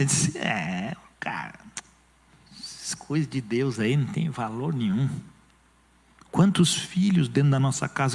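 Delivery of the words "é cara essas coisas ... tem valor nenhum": scoffing Esau. Acted. Pastor acting Esau laughing off the blessing, low and dismissive. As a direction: brush off God's blessing with a sneer. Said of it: "Ele riu daquela bênção".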